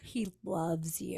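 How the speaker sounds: slow, hushed whisper. Retold or imitated. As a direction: confide it as the kid's mom, barely voiced. Mom privately relays child's crush.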